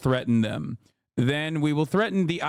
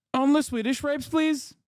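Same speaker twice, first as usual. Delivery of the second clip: higher mock pleading; 10 semitones higher than usual. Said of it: "I'm doing a Norwegian accent".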